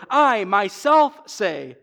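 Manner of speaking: slow, loud weight on "I myself"; "I myself" stressed and drawn out; emphatic I myself. Shown as a demonstration. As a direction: lean hard on "I myself". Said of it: "And it's emphatic, it adds emphasis".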